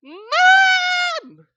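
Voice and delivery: put-on high, loud child's yell; shouting for mom. Little girl running off, shouting for her mom. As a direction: yell for mom across the house. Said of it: "you hear her shouting".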